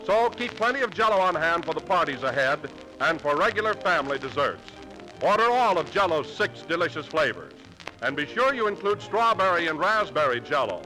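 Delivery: ordinary talk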